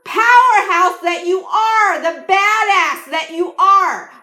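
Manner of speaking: slow, high, booming yell to wake someone up; yelling from the gut. Shown as a demonstration. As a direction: belt it out to slap the listener awake. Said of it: "yell at you like that".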